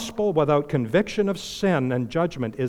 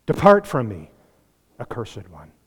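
stern judgment; 6 semitones lower than usual. Retold or imitated. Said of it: "Jesus is gonna say".

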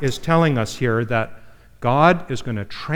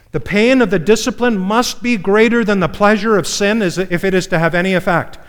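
emphatic preaching; 5 semitones higher, 5 dB louder than usual. Real, not acted. First statement of the principle.

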